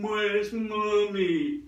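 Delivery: put-on high, drawn-out baby animal voice; baby elephant. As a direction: stretch it out like a lost little elephant calf. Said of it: "alive little elephants going".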